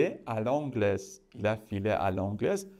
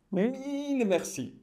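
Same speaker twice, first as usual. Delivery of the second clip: much higher, squeaky put-on voice; high silly thanks; 11 semitones higher than usual. Shown as a demonstration. Teacher thanking viewers in a high playful voice. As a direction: chirp it playfully. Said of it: "high put-on thanks".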